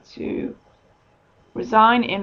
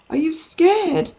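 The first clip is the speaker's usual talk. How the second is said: worried colleague asking; 8 semitones higher, 4 dB louder, slower than usual. Retold or imitated. Colleagues ask worried question.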